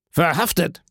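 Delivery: a joyful exclamation of arrest; triumphant cry. Acted. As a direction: shout it like a victory. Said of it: "rief Villefort".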